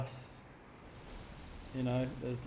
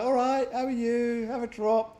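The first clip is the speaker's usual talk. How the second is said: friendly Crow impression; 7 semitones higher than usual. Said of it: "He's like".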